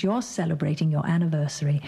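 normal talking voice

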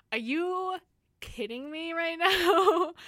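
high, loud and slowed down in disbelief; can't believe the weather; exasperated disbelief. Retold or imitated. Outrage at the weather turning cold.

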